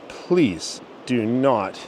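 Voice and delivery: "please do not" drawn out word by word; slow stressed plea. Real, not acted. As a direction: stretch "please do not" very slowly. Pleading with viewers, slowed.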